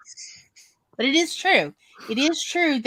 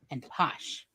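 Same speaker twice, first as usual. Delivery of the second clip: whisper; 6 semitones lower, 10 dB quieter than usual. Real, not acted. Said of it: "whispered aside".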